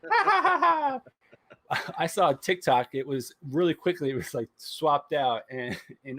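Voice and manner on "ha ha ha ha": high cackling ha ha ha; mock evil laugh. Meme Disney laughing at Scarlett, high mock cackle. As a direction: cackle like a cartoon villain.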